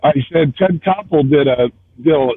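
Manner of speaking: normal talking voice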